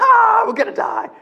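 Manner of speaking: shrieking high mock terror; mock panic. Preacher mocking himself in a boat, panicked shriek. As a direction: squeal like you're sure you'll drown. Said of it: "I'm like".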